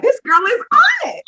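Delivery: high-pitched impressed exclamation; amazed squeal. Retold or imitated. Aunt marveling at her capable niece, amazed squeal.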